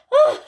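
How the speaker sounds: very high, loud cry; shivering oh. Acted. Susan stamps her feet in the cold, crying out. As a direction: gasp at the cold.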